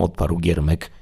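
ordinary delivery

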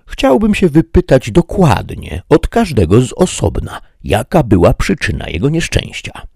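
lightly voiced noble questioner; Don Quixote asking. A narrator's reading. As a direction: insist politely on hearing each story. Don Quixote asks the guards.

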